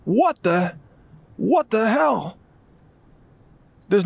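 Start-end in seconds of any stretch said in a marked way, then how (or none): 0.1-2.4 imitating a stunned bystander, voice jumping much higher on whoa; slow, aghast what the hell; mock shocked onlooker. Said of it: "you're like".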